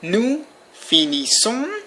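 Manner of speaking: "finissons" drawn out slowly, ending on a nasal on; slow model of "finissons" with the final S unsounded; silent final S. Shown as a demonstration. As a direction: say "finissons" slowly as fee-nee-son, no last S. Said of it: "without pronouncing the S".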